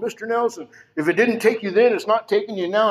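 high, insistent nurse's voice; urgent nurse. Retold or imitated. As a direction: imitate a nurse rallying a patient. Nurse urging collapsed patient on gurney.

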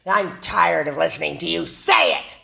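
a hint of a bitter old man yelling; mock old-timer yell. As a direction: play the cranky old-timer telling her off. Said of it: "him yelling at me".

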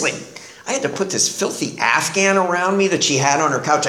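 ordinary talk, nothing marked

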